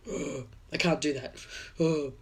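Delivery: a failed stab at a deep, rumbling lion king; James Earl Jones voice. Retold or imitated. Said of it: "better with James Earl Jones's voice".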